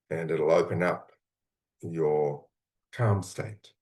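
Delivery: calm, unhurried guiding voice, with long pauses; guided visualization. Acted. Coach guiding listeners into a calm imagined place, gently.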